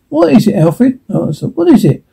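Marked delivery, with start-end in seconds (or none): 0.1-1.0 high, startled question; alarmed wife calling out, voice jumping up. Acted. Wife frightened by the noise asking her husband, alarmed. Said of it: "Ma, alarmed". 1.5-2.0 much higher, pleading questions; frightened wife pressing for an answer; alarmed. Acted. Mother begging her husband to explain the noise, alarmed. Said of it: "Ma, alarmed".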